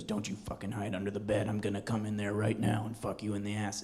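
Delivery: menacing, hushed caller voice; creepy phone threat. Retold or imitated. Caller threatening the boy hiding under the bed, menacing. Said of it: "he goes".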